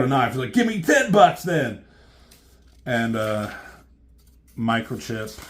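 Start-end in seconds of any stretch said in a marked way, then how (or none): 0.5-1.8 much higher and louder demand; hammed-up thug voice. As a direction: squeal a cartoon mugger's threat. Homeless guy demanding with knife.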